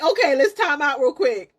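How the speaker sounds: high, loud, giggly plea; laughing while talking. Retold or imitated. Laboring mom asking for a timeout, laughing. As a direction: crack up on the imagined request.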